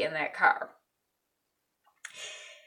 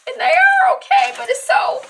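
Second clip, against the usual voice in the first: laughing through tears; 9 dB louder, slower than usual. Real, not acted. Overcome retelling the show's ending.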